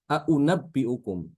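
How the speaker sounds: Aunabbiukum slow with both hamzas fully voiced; clear tahqiq reading. Shown as a demonstration. Teacher modelling the clear takik reading to students. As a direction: pronounce every hamza of Aunabbiukum clearly and slowly. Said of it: "Dua-duanya dibaca takik".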